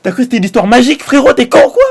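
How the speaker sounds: fast, high and loud mocking outburst. Real, not acted. Gamer mocking the roleplayer's time-stopping story, fast and loud. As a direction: blurt it out in disbelief.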